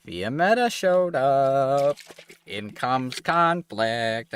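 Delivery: slow, drawn-out trailer voice; dramatic announcer. Pretend. Streamer dramatically narrates arrival.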